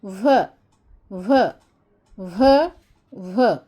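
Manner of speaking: "व" as a short va, not "vee"; letter sound v. Shown as a demonstration. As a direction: sound out "व", repeated. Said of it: "इसे पढ़ते हैं व".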